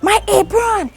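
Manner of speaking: high, loud accusing shout; mock yelling coworker. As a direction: shout it like she did.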